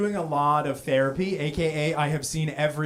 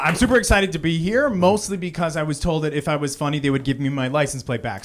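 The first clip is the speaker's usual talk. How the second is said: ordinary talk, nothing marked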